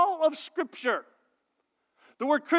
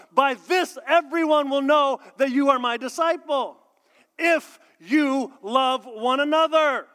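preaching; 4 semitones higher, 5 dB louder than usual. Acted. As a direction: build it to a ringing proclamation. Preaching at full force.

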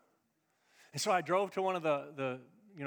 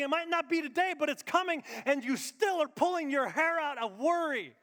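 loud, high pulpit preaching; full-force sermon delivery; 9 semitones higher, 6 dB louder than usual. Acted. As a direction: preach hard to the congregation.

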